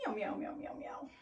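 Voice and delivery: soft, airy string of meows; quick breathy meows trailing to nothing; cat breath meows. Shown as a demonstration. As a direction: meow repeatedly on one exhale, fading. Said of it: "cat breath".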